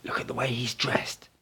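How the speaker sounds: put-on an old man whispering to his student about a passer-by. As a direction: murmur it so only your companion hears. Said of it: "Don Juan said in a whisper".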